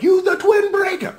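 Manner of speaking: high shouted command, leaning on twin; Sento's battle cry. Retold or imitated. Retelling Sento's command to use the weapon, shouted high. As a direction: bark it out like a hero calling his weapon. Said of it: "Sento like".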